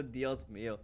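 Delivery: low, soft, deadpan Dios mío; understated mock dub sigh. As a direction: drop it flat and quiet like a tired dub. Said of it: "There's always a".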